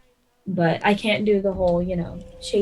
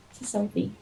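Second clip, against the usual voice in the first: whisper; 10 dB quieter, faster than usual. Real, not acted. Quiet aside while snapping selfie.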